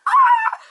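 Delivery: high loud shocked ah; crowd roar. Retold or imitated. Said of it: "fans going".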